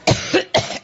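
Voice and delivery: deep hacking cough; chesty cough. Real, not acted. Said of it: "excuse me".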